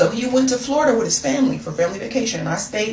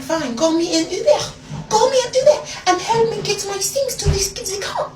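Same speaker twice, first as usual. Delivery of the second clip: put-on high, indignant French-accented command; huffy French woman, pitched high; 12 semitones higher than usual. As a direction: order them about in a mock French accent. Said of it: "turned into a whole French woman".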